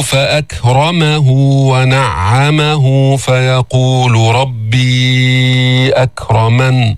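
slow, drawn-out chanted recitation; Quran recitation. Shown as a demonstration. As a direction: recite each verse slowly and melodically, letting the vowels ring. Teacher recites next verses.

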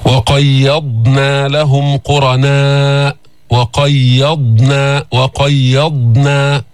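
وقيضنا very slowly, with a still ض and no bounce; tajweed chant; slow recitation. Shown as a demonstration. Quran recitation.

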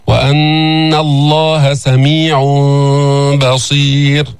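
وأن الله سميع بصير chanted slowly, lips left slightly open on the nasal before بصير; faulty tajweed chant, unclosed hum after سميع; wrong gapped-lip recitation. Shown as a demonstration. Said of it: "لا تقرأ هكذا".

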